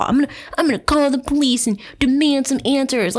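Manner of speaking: high-pitched mock entitlement; put-on whiny imitation of a pushy caller. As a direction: imitate her demanding answers, mockingly. Mocking the author's entitled demand.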